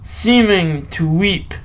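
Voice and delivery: slow, measured poetry reading with long pauses; poem recital. Acted. Reading a Byron poem to listeners, slow recital. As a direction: let each line breathe like a recital.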